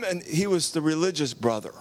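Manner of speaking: ordinary delivery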